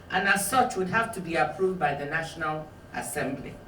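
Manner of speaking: calm, even reading from a memoir; measured author reading; book reading. Acted. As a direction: read the passage steadily to the audience. Reads aloud from her book.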